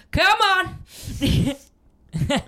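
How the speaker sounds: sudden high yell, full volume; old man yelling. Retold or imitated. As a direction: shout it out like the guy in the back at the meeting. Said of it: "he'd go".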